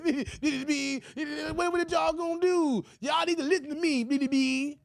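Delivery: high shouting stammer; Roland Martin impression. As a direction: yell it squeaky and sputtering. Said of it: "he Porky Pig".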